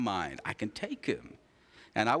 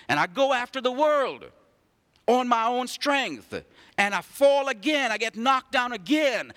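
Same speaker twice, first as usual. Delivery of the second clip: higher and louder, rising to a peak; building sermon; 8 semitones higher, 3 dB louder than usual. Acted. Pastor rallying the congregation, building to a cry. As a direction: drive it home to the crowd.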